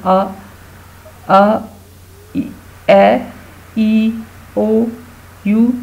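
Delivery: each vowel sounded out separately: अ, ए, ई, ओ, यू; phonics vowel sounds. Shown as a demonstration. Teacher demonstrating vowel sounds to pupils, phonics sounding-out. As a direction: say अ, ए, ई, ओ, यू one by one, pausing between. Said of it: "इनकी sounds pronounce करें".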